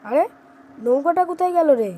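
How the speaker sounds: sudden alarm at the missing boat; startled alarm. Acted.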